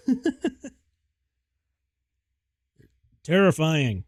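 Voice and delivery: sudden loud yell. Real, not acted. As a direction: blurt it out loud, high. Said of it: "I didn't mean to yell".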